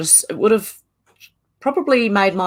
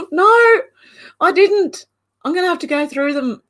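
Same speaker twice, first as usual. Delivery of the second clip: much higher voice, starting loud; high-pitched admission; 11 semitones higher, 4 dB louder than usual. Real, not acted. Telling a viewer she missed the clip, high-pitched admission. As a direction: open on a loud high no, then ease off. Owns up to not watching clip.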